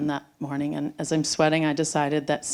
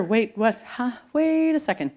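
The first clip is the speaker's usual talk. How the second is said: Wait, what? jumping up in pitch, confused; sudden surprise; 7 semitones higher than usual. Retold or imitated. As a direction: catch yourself mid-sentence, startled. Realizing the goodbye call.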